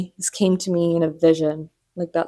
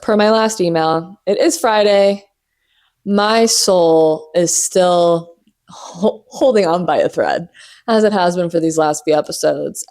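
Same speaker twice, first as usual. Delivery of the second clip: ordinary talk, nothing marked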